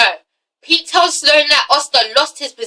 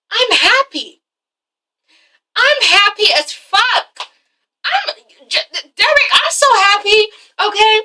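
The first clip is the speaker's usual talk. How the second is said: happy outburst; 9 semitones higher than usual. Retold or imitated. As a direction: burst out with overjoyed insistence. Asha insists she's happy.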